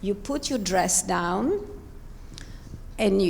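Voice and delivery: ordinary delivery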